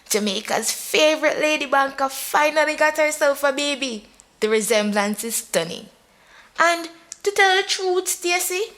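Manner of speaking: high, relishing mock announcement; reading the gossip out. A narrator's reading. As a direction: savour every juicy word. Friend reads gossip column aloud.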